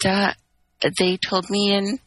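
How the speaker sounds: usual speaking voice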